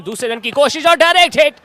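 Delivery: sudden high loud burst; excited cricket commentary. Real, not acted. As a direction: yell the direct hit. Run out by direct hit.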